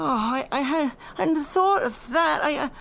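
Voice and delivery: high, shaken realization; frightened. Acted. Professor realizing the danger, frightened and high. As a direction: voice climbing in fear as it dawns.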